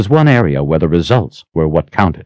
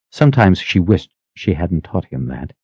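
ordinary delivery

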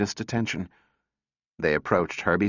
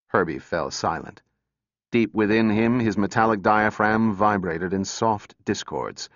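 usual speaking voice

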